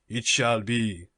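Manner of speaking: slow, deliberate master's voice; galley master voice. Acted. Galley master speaks over pearl.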